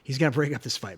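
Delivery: ordinary talk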